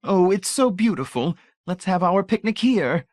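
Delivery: high, bright delighted exclaim; voiced Mrs Pritchett, delighted; delighted cry. A narrator's reading. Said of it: "gave a delighted cry".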